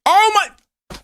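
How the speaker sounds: very high squeal of shock; shocked cry. Real, not acted. Streamer reacting to the game result. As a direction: yelp it in disbelief.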